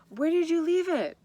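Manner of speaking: high, loud call across the din; calling over the noise. Acted. Edith calling to the mother over the noise, loud high call. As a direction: shout it over the boat engines. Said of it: "called Edith over the noise".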